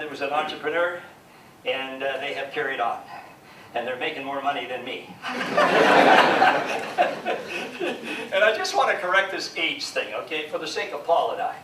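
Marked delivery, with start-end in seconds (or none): 5.2-8.3 laugh. Real, not acted. Laughs after money joke.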